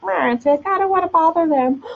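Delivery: high, small voice imitating someone holding back; mock reluctance. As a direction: squeak it out like a timid people-pleaser. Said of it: "being all like".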